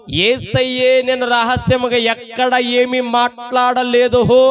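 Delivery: high, slow, ringing declaration; bold proclamation. Retold or imitated. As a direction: proclaim it boldly in Jesus's words, drawn out. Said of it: "దమాయించి కొడుతుంటే".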